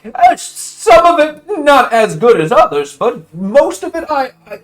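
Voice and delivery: high, loud, eager wheedling; butter up the queen's rival; nervous flattering messenger. Acted. Wallaby sweet-talking the crustacean boss, high and eager.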